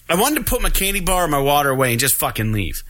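ordinary delivery

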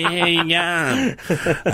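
high, exaggerated joke greeting, finally stretched right out; hammed-up slang greeting. Clowning with co-host over proper English, hammed-up and drawn out.

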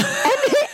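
short high laugh; quick burst of a laugh. Real, not acted. Laughing at own joke.

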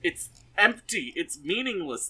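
loud, high, slowed-down outrage; exasperated rant. Real, not acted. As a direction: drag out each damning word in disbelief. Ranting that the show is empty.

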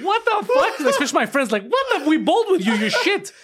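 high, incredulous mock outcry; the bowling friends react to the win, high and incredulous; stunned disbelief. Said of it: "everybody's like".

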